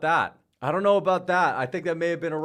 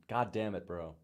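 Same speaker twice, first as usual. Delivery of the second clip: usual speaking voice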